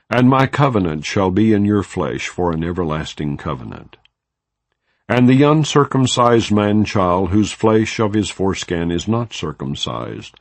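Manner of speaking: plain reading voice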